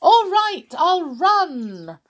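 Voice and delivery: put-on high, loud cheery old man; saucepan man voice. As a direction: bellow it out happily.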